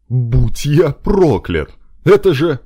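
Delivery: loud, high astonished cry; astonished exclamation. Acted. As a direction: gasp it out in shock. Marshal astonished at friend. (громко и изумлённо)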